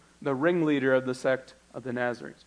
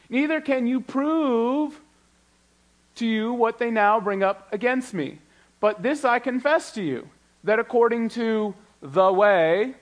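slow, high, ringing Bible reading; preacher reading out the charge against Paul, slow and lifted; dramatic scripture reading; 7 semitones higher, 6 dB louder than usual. Acted. Pastor voicing Tertullus's charge to the congregation, slow and lifted. Reading Paul's defense aloud.

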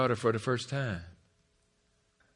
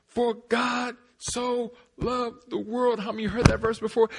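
higher, stretched-out verse proclaiming; fervent preaching; 12 semitones higher, 5 dB louder than usual. Real, not acted. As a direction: proclaim the verse with rising fervor.

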